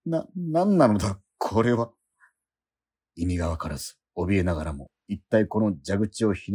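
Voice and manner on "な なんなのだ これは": high, loud stammering alarm; startled and frightened. Acted. Man reading the strange manual, stammering in alarm.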